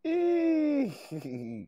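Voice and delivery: high squealing laugh. Real, not acted. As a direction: burst out laughing at your own act. Cracks up after own impression.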